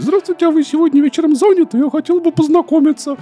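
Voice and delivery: man doing a woman's voice. Retold or imitated. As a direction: squeak it out like a lady caller. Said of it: "женским голосом говорит".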